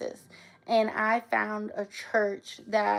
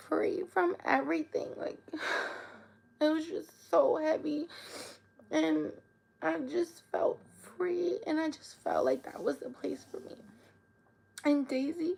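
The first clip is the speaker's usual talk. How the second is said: soft breathy near-crying whisper, higher; tearful whisper; 5 semitones higher, 5 dB quieter than usual. Real, not acted. Telling viewers about finding church, a tearful whisper. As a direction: voice almost gone with tears, hushed. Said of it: "I'm getting emotional".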